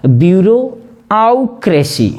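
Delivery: ब्यूरोआउक्रेसी broken as "byuro... au... kresi"; ब्यूरोआउक्रेसी in three detached chunks; spelling pronunciation. Shown as a demonstration. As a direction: sound a separate "au" inside ब्यूरोआउक्रेसी. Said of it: "हमको पढ़ना पड़ेगा".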